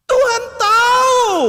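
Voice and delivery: high, loud outburst, slowed; exasperated shout. Acted. Preacher answers for God to the chatty pray-er, exasperated shout.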